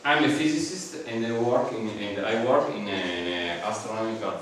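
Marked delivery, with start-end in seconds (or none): none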